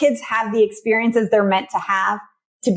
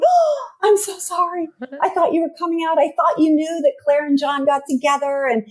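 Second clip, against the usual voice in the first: mock apology; 5 semitones higher than usual. Susie spoiling the plot to friends, sugary fake apology. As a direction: apologise sweetly while spoiling everything.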